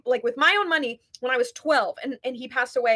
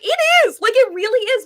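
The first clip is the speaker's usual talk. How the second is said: much higher and louder, vindicated; triumphant agreement; 10 semitones higher, 8 dB louder than usual. Retold or imitated. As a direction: pounce on his concession with glee.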